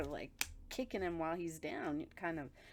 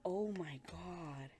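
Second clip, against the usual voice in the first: slow whispered look at this; slower than usual. Real, not acted. Reacting to the kid's post, whispered slowly. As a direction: breathe it out in awe.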